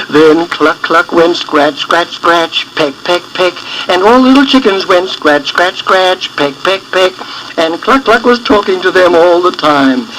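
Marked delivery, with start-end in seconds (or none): none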